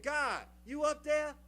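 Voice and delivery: slow, loud and high call upward. Retold or imitated. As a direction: shout up the mountain to God, slowly. Man hanging calls up to God.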